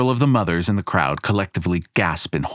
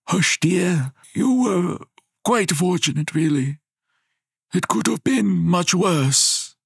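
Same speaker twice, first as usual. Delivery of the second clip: put-on higher, slow soothing old man; comforting grandfather; 12 semitones higher than usual. A narrator's reading. Grandfather soothes his crying granddaughter, slow and gentle. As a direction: hush the crying child.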